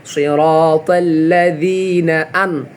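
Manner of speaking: normal talking voice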